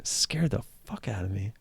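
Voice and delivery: unvoiced, breathy aside; whispered. Real, not acted. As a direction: breathe it out, still shaken. Startled by loud alert.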